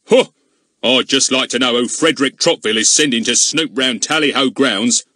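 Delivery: loud, indignant village constable; blustering policeman. Acted. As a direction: bluster like a suspicious bobby. Narrator voices Goon.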